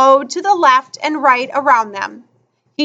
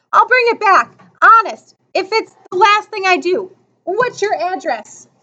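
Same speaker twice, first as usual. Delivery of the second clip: put-on higher, earnest boyish assurance; eager promising; 6 semitones higher than usual. Boy promising to return a borrowed book, eager. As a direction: promise eagerly, voice lifted throughout.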